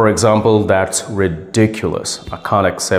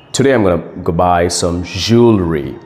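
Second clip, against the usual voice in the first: ordinary talk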